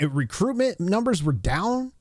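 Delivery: ordinary delivery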